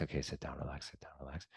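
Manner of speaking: fading from soft speech into a whisper; soothing self-talk. Shown as a demonstration. Coaching yourself to sit and relax, fading to a whisper. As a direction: settle yourself down, dropping to a whisper.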